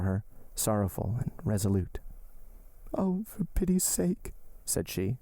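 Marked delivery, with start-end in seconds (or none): 2.9-4.3 put-on higher, slower anguished young woman's voice; young woman pleading. Young woman begging her beloved, slow and high. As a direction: beg him, voice lifted high. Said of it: "Valentine speaks to Maximilian".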